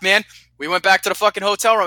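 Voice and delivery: usual speaking voice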